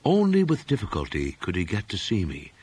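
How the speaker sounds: ordinary delivery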